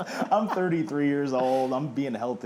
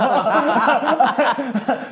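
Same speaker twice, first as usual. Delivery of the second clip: big real laugh, much higher and louder; loud burst of laughter; 13 semitones higher, 12 dB louder than usual. Real, not acted. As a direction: crack up at the memory. Laughing at ten-year-old host.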